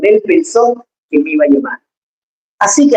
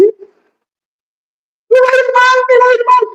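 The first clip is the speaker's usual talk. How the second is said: very high, drawn-out whimpering moan; mock a fearful complainer, slowly; hammed-up high whine; whiny pessimist; much slower than usual. Mocking fearful pessimistic believers.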